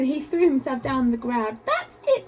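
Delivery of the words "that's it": exasperated cry. Acted. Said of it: "he cried".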